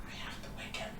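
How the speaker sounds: voiceless, soft character whisper; hushed in-character whisper. Acted. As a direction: whisper it low as if keeping a secret. Game master voices a whispering character.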